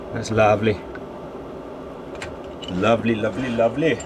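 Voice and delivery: lilting Welsh accent, lightly put on; a touch of sing-song Welsh. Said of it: "going all Welsh".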